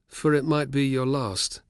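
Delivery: slow, grave finishing of a saying; a touch of solemnity, unhurried. Acted. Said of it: "in a solemn tone".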